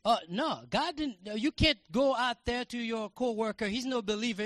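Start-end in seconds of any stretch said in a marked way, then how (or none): none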